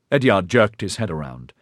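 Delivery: ordinary delivery, nothing marked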